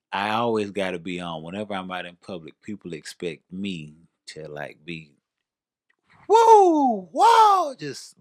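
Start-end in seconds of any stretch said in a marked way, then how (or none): 6.3-7.7 loud, high-pitched "woo" and "whoa"; high whooping cheer. Acted. Performer showing how they must be on in public, high loud whoops. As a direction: whoop like a hyped-up entertainer. Acts out being always on.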